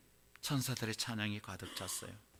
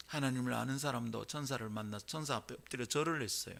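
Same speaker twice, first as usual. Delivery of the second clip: ordinary talk, nothing marked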